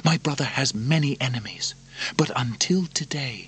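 high, hushed, partly whispered urging; conspiring princess. Acted. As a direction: Lucilla plotting. Narrator voices Lucilla.

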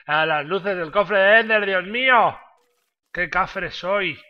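high, loud self-mocking outburst; loud exclamation. Real, not acted. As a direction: exclaim loudly at own blunder. Realizes his mistake.

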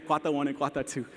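ordinary talk, nothing marked

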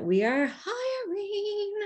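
high, drawn-out, giddy excitement; excited announcement. Real, not acted. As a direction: announce it slowly in a high, thrilled voice. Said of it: "I am so excited".

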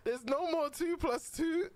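high incredulous question; incredulous repeat. Real, not acted. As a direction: repeat the lyric in disbelief. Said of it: "That ain't even the lyric".

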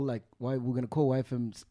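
ordinary talk

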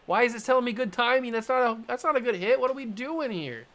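high, indignant complaint at the game; frustrated outburst. Real, not acted. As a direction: demand an answer from the screen. Gamer frustrated at bad hit.

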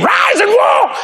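very high prophetic command; soaring, shouted pulpit command. Shown as a demonstration. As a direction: cry it out as a healing order. Demonstrates commanding a healing.

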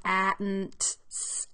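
"A, n, t, s" sounded out one by one; each sound of "A, n, t, s" separate, t and s voiceless; segmented sounds. Shown as a demonstration. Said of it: "Four sounds".

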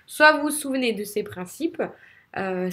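usual speaking voice

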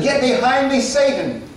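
stern rebuke. Retold or imitated. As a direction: point the finger and rebuke. Jesus rebuking Peter, voiced.

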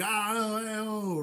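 high, jolly exclamations; jovial whoops. Retold or imitated. [name] showing jovial excitement at muster, high whoops. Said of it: "if I'm being very jovial and like".

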